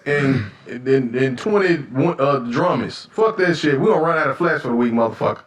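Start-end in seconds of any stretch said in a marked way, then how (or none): none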